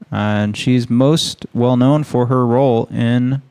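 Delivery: normal talking voice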